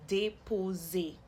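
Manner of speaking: déposer slowly, as day-po-zay; two acute accents. Shown as a demonstration. Teacher showing two acute accents, slow careful pronunciation. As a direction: give déposer two closed ay sounds, unhurried. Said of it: "two of the acute accents".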